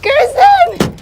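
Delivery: calling a name high and loud. Real, not acted. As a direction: shout across the car park. Calling out for friend.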